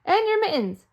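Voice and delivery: insisting. Acted. Wife insisting.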